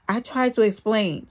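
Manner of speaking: ordinary delivery, nothing marked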